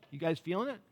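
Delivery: ordinary delivery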